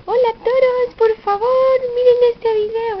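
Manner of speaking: put-on high, bright baby-talk greeting to viewers; sing-song greeting. Shown as a demonstration. Mother modelling the baby's hello to viewers, high sing-song. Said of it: "dígale".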